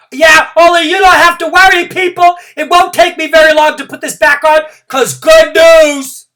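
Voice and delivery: very high, loud reassuring yell; loud call-back. Acted. Junie B yelling to room nine not to worry, very loud. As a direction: yell across to the team.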